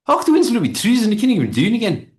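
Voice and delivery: imitating a broad Scottish speaker; Scottish accent. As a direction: rattle it off in a thick Scottish accent. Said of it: "it's a Scottish accent".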